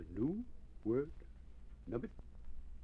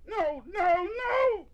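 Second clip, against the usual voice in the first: loud, very high storybook wail; tearful high-pitched shouting; wailing cry; 9 dB louder, slower than usual. A narrator's reading. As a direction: cry out in despair, pitch at the top. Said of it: "cried Nubbet, weeping large-sized tears".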